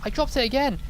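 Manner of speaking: real startled cry as the dynamite drops; alarmed yelp. Real, not acted. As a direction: blurt it out in sudden alarm. Dropped dynamite again.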